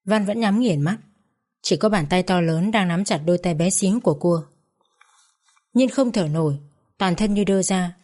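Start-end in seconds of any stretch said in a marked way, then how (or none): none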